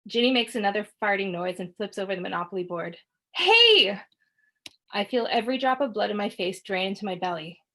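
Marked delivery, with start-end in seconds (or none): 3.3-4.0 high loud yell; outraged shout. Acted. Boy yelling at sister who flipped the board, loud. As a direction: yell in protest.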